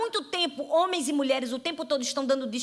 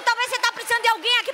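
ordinary delivery

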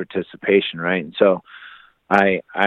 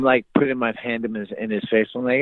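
normal talking voice

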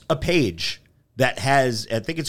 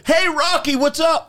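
much higher, enthusiastic mock hello; excited fan greeting; 13 semitones higher than usual. Fan greeting a movie star by role, excited imitation. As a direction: call out like a delighted fan.

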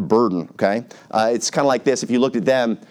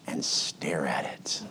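slow, dreamy whisper; hushed whisper; 9 semitones lower, 13 dB quieter, slower than usual. Acted. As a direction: breathe it like gazing at something adored. Staring lovingly at the car.

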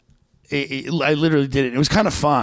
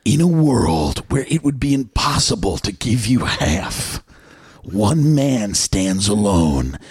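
movie trailer voice; slower than usual. Pretend. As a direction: announce it like a blockbuster trailer. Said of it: "the movie trailer voice".

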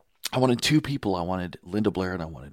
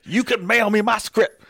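laughing Rudy imitation; 14 semitones higher, 9 dB louder than usual. Retold Rudy's request, laughing.

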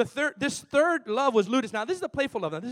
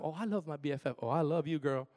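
soft, sweet gushing over a best friend; mock gushing friend; 6 semitones lower, 7 dB quieter, faster than usual. Preacher mimicking people gushing to a friend, soft and sweet. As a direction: coo it like a sappy bestie. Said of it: "that's what we do all the time".

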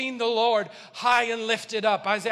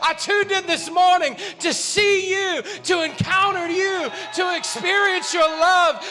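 high, loud impassioned preaching; preaching at full force; 8 semitones higher, 8 dB louder than usual. Real, not acted. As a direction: preach it full throttle to the church.

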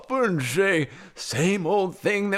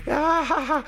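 high, triumphant "ah-ha-ha"; hammed-up gloating laugh; villain laugh; 9 semitones higher than usual. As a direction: cackle over a diabolical plan. Villain's laugh at her perfect plan.

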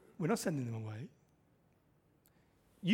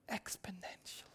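whisper; 18 dB quieter than usual. Real, not acted. Sermon climax, whispered word.

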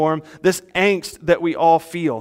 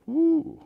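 dramatic ooh; 11 semitones higher, 5 dB quieter than usual. Real, not acted. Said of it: "reacting to God's wrath verse".

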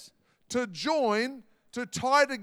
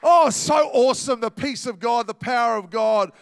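exultant shout; 7 dB louder than usual. Real, not acted. Preacher exulting in God's power.

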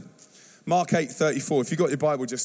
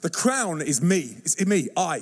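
ordinary talk

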